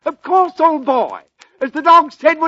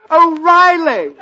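laughing punchline; 5 semitones higher, slower than usual. Acted. Punchline shouted through laughter.

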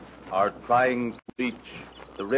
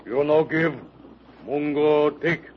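dopey cartoon bellow; 6 semitones higher than usual. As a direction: grunt it like a dim giant. Savage chief demands rifles.

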